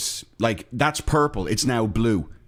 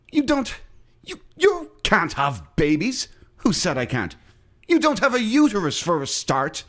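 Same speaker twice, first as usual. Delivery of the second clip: high, indignant sketch-character objection; Monty Python bit; 7 semitones higher than usual. Retold or imitated. Host re-enacting a Monty Python bit, high mock-indignant voice. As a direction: pitch it up like a baffled sketch character. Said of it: "Monty Python bit".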